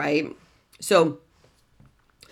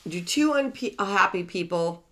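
normal talking voice

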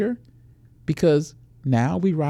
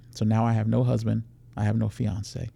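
usual speaking voice

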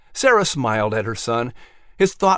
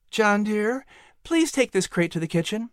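put-on fond mother greeting her birthday boy; mother softly praising her son; tender mother; 8 semitones higher, 3 dB quieter than usual. Mother instructs older son.

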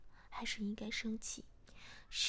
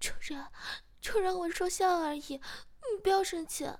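high, loud, panicked begging; struggling plea; 8 semitones higher, 11 dB louder than usual. A narrator's reading. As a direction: plead urgently while struggling. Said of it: "不停地挣扎着说". (挣扎着高声求饶)

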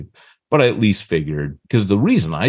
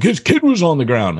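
indignant accusation; 9 semitones higher, 4 dB louder than usual. Retold or imitated. Guy accuses the speaker.

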